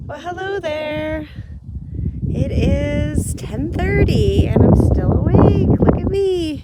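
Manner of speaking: loud, high, slow hello; cheery greeting. Real, not acted. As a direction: greet the camera brightly. Greets viewers in new late clip.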